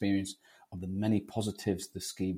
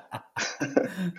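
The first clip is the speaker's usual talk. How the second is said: laugh; 9 semitones higher than usual. Real, not acted. Host laughs at guest's joke.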